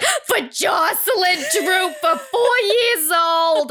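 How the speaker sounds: slow, high, hammed-up grandmother wail; grandmother laying it on. Grandmother laying it on thick for Jocelyn, over-the-top wail. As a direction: draw it out up high, losing it. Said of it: "grandmother's really laying it on thick".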